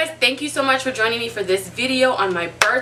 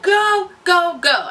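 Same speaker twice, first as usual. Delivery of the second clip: retold urging; 8 semitones higher, 4 dB louder, much slower than usual. Retells urging husband to go.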